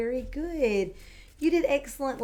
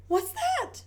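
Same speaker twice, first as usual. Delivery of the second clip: startled little pig. Acted. Peppa startled at dinner.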